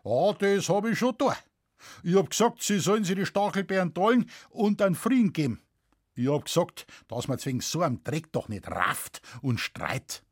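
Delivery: earthy farmer giving evidence; Bavarian peasant witness. Acted. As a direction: testify in broad Bavarian dialect, animated. Witness's speech in story.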